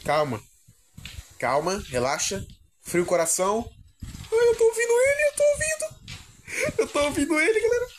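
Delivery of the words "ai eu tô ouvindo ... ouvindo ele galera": high frightened whimper; terrified, voice shooting up; panicked. Real, not acted. Hears monster, panicking.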